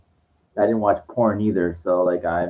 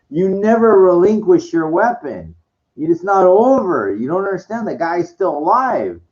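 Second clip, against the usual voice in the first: worked-up rant; 7 semitones higher, 4 dB louder than usual. Real, not acted. Scolding Luke in the movie.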